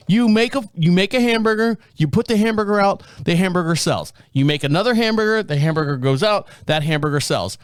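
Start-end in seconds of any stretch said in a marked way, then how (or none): none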